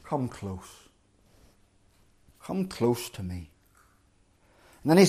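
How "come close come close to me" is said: much softer and lower, gentle; tenderly. A narrator's reading. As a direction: coax the frightened brothers near. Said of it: "Joseph says tenderly".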